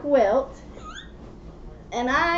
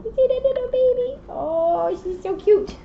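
cooing; 10 semitones higher than usual. Real, not acted. Cooing over baby picture to child.

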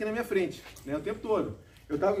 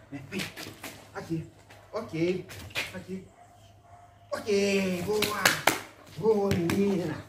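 soft coaxing and praise; talking to a dog; 5 dB quieter, much slower than usual. Real, not acted. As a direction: call and praise the puppy. Training the dog with a toy.

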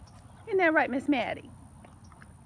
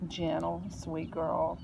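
ordinary delivery

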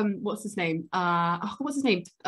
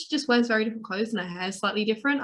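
ordinary talk, nothing marked

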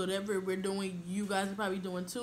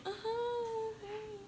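mock shy girl, higher and mumbled; shy mumbling; 13 semitones higher, much slower than usual. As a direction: play the timid new kid, barely greeting. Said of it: "and you're just walking like".

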